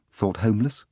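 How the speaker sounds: ordinary delivery, nothing marked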